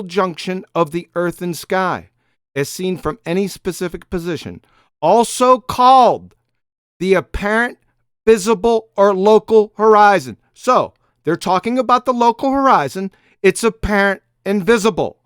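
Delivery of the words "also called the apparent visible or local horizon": louder, with pauses between the terms; slow, emphatic reading. Real, not acted. Reading the definition again to prove the opponent wrong, slow and emphatic. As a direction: read it out slowly, landing hard on each term. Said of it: "I will read it again, slowly".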